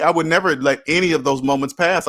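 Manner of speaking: ordinary delivery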